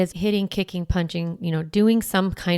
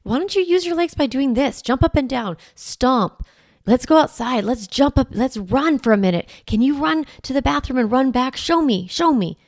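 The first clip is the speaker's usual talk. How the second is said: upbeat redirecting; 6 semitones higher than usual. Shown as a demonstration. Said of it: "So we're like".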